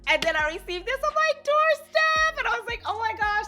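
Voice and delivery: high, excited telling; breathless high delight; excited squeal. Real, not acted. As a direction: squeal as the package arrives. Receiving package on doorstep.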